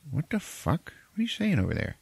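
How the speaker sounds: high-pitched, incredulous retort; mock disbelief; baffled sister. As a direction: imitate a bewildered sister, pitch jumping up. Retells sister startled by odd answer.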